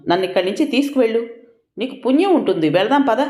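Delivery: high, quick, distressed plea to leave; a wounded mother begging her brother to take her home; pleading mother. A narrator's reading. Hurt mother begs brother leave.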